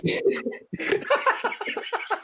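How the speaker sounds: airless, high giggle; real laugh. Real, not acted. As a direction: giggle helplessly, almost silent. Laughing at own bald story.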